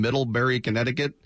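ordinary talk, nothing marked

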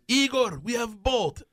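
buddy bursting in with good news, high and eager; high excited Russian buddy. Retold or imitated. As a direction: announce it like a triumphant friend. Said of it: "comes up and goes".

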